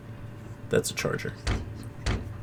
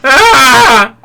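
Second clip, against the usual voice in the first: high scream; 12 semitones higher, 8 dB louder than usual. Acted. Scream in the game scene.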